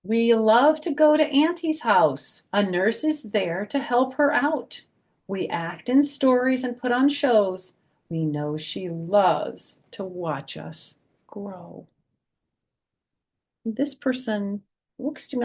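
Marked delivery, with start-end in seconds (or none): 0.0-11.9 higher, louder sing-song storybook read; bouncy rhyming picture-book voice; reading to children. Acted. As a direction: read the rhyme aloud to a group of little kids. Reads rhyming picture book aloud.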